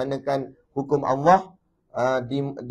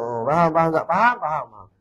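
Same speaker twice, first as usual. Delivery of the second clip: mock nodding agreement; 2 semitones higher, 3 dB louder than usual. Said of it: "hangguk-hangguk".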